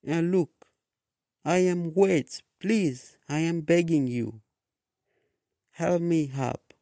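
high, urgent begging voice; pleading. A narrator's reading. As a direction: plead for help, pitched well up.

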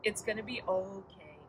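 gentle, quiet hushing; a tender, hushed hug in words; soft reassurance. Retold or imitated. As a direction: comfort a child softly. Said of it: "God comes along and wraps me up".

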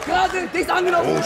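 high, sudden exclamation; excited oh. Real, not acted. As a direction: react to a clean hit. Commentator reacts to a punch.

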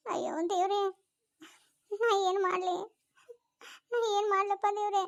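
sobbing, pleading with god; crying despair. Acted. Sister hearing she can never be a mother, weeping. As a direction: break down in tears, crying out to god.

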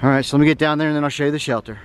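normal talking voice

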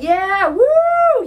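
replaying the cheer from dancing with Alice; reenacted whoop. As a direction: whoop it up like on a dance floor.

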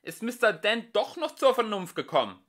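loud, high-pitched called question; calling out. A narrator's reading. Prosser asking after Mister Dent, calling loudly. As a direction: project the question high across a distance. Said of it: "rief er".

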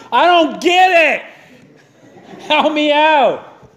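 high-pitched comic pleading; hammed-up exasperated whine, dragging out the end; mock exasperation. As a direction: plead in bafflement with a demanding cat. Acting baffled owner pleading with cat.

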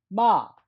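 마 starting high, falling sharply, loud; fourth tone. Shown as a demonstration. Teacher models tone four for students, loud falling 마. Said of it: "한 번 더".

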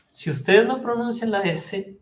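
usual speaking voice

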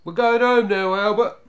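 high, loud call across distance. A narrator's reading. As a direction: holler it to someone out of sight. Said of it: "called the eldest".

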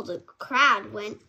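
plain reading voice

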